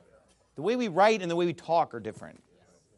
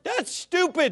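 higher, whiny mock quote; mocking imitation; 7 semitones higher, 3 dB louder than usual. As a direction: sneer it like him. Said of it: "He's like".

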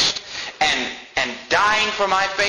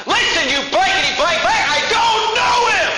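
furious high shout, much louder; cursing shout; 4 dB louder than usual. Retold or imitated. Peter cursing at the servants, furious high shout. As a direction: bellow the denial at them. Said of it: "starts cursing him out".

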